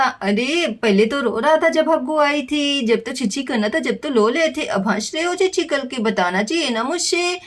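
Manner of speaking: put-on hushed, fond telling-off of a baby; soft teasing scold. Aunt softly teasing the baby for laughing after soiling. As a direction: tease the baby gently and quietly.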